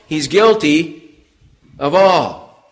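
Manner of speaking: drawn-out, weighty words; preacher slowing to hammer it home; slow emphasis. Acted. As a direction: lean hard on each word.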